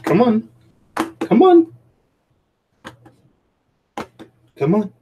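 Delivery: high sing-song coaxing call; coaxing a dog. Real, not acted. Calling the dog up.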